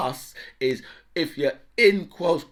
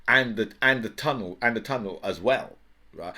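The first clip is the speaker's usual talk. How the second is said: ordinary delivery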